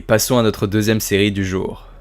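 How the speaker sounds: normal talking voice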